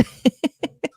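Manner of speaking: high giggle; a short high laugh. Real, not acted. Laugh after silly voice.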